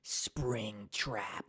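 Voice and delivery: low, soft Helpy character voice. Shown as a demonstration. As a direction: put on the Helpy voice, slow and low. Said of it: "It's just me going".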